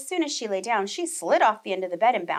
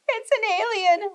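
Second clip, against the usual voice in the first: ordinary delivery, nothing marked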